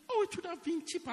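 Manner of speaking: high, quick sing-song mockery; high mocking whine. Preacher mocks churchgoers' cheap complaint, high quick whine. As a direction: mimic the whiny complaint, high and fast. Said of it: "So they say".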